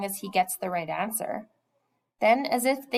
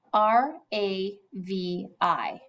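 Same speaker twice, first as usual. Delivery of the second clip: spelled out; much slower than usual. Acted. Spelling out a name card.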